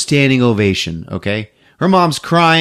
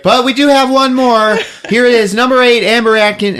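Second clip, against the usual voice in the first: higher, louder chirpy host voice; bright higher announcer impression; mock host; 11 semitones higher, 4 dB louder than usual. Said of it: "And she's like".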